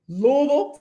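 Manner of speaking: Louisville said LOO-uh-vul, middle swallowed; local pronunciation. Shown as a demonstration. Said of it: "say it with me".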